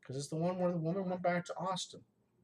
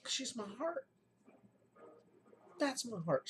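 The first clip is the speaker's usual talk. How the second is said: high, pleading, choked-up insistence; emotional; 7 semitones higher than usual. Real, not acted. Telling Kim and viewers he can't hate her, emotional. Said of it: "I'm extra emotional".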